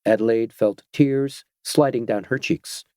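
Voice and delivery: usual speaking voice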